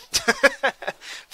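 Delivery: high, loud burst of laughter. Real, not acted. Amused by favourite footballer's name.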